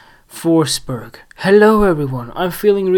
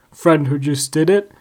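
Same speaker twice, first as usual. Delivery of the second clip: ordinary delivery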